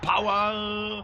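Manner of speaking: loud mock cheer; power shout. Cheering engine before crash.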